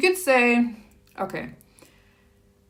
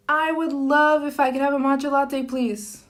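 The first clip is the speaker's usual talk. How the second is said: polite customer voice; 7 semitones higher, 4 dB louder than usual. Shown as a demonstration.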